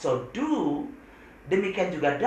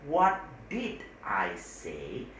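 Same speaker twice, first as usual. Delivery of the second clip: slow demo question; 7 semitones lower, much slower than usual. Shown as a demonstration. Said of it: "teacher repeats key question".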